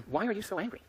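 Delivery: high, tentative acted question; puzzled questioning. Someone approaches you years later, puzzled questioning.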